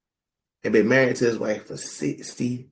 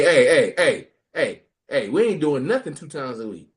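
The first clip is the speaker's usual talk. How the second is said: ordinary talk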